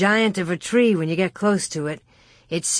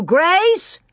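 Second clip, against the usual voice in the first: high, rising shout; 10 semitones higher, 4 dB louder than usual. A narrator's reading. Pa joining the search for Grace, shouting high. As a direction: shout the name into the distance. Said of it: "Pa shouted too".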